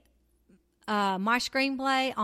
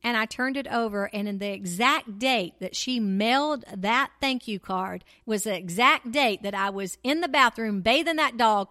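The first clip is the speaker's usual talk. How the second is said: ordinary talk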